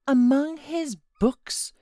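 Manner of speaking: high, loud, slow disbelieving cry; cried out, confounded. A narrator's reading. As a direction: fling the words back in shock. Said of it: "she cried, confounded".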